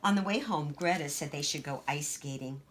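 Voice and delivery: plain reading voice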